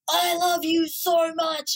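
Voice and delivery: mock-angry shout at the pet; angry yell. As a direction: bellow the loving words like a threat. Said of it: "in a very angry tone".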